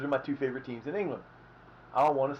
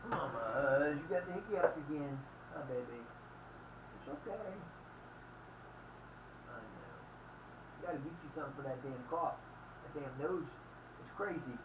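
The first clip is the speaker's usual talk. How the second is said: tender; 11 dB quieter than usual. Real, not acted. Talking softly to his sick dog.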